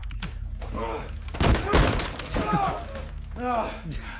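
high breathy howl of laughter; burst of laughter. Real, not acted. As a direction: explode into helpless laughter. Laughing at swat clip.

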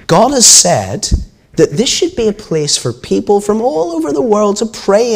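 high, emphatic storybook reproach; stern rebuke. A narrator's reading. Jesus to the temple traders, sternly rebuking.

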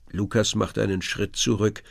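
usual speaking voice

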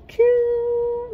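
Q squeaked way up high; high squeal. Shown as a demonstration. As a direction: pop the letter Q up high. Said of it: "alphabet continued".

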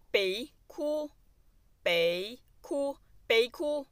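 stretched out, very slow, for learners; drawn out word by word like a teacher. Shown as a demonstration. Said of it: "slow model of phrase". (พูดช้ามากแบบครูสอน)

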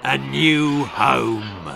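very slow, growling troll voice; growled. A narrator's reading. Trolls telling the boy what they want, growling. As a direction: growl it out like a monster. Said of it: "they growled together".

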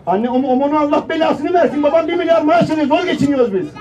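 high-pitched exasperated complaint; mock ranting mother. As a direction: rant loudly like a harried housewife. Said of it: "Annem olacak reklamda". (söylenen anne taklidi)

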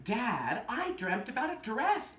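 story reading. Acted. Reads picture book aloud.

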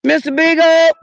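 loud high call for the dog; yelling a dog's name. Real, not acted. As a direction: shout the name into the trees. Calling for lost dog.

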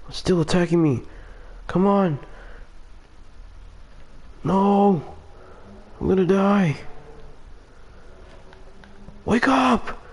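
high, rising panicked cries; scared horror game streamer. Real, not acted. Chased by T-Rex, panicking.

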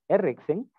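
"erexen" said quickly, rising at the end; brisk Greek word. Shown as a demonstration. Teacher repeating the Greek word at normal pace, rising.

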